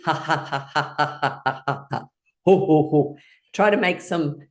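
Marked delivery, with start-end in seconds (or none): 0.0-2.0 deliberate, even ha-ha laughing; belly laugh. Shown as a demonstration. Teacher showing laughter from the belly, practised belly laugh. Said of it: "come all the way up from the belly". 2.4-3.2 loud, rounded ho-ho-ho; Santa laugh. Shown as a demonstration. Teacher showing unusual laughing sounds, Santa laugh. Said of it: "like Santa".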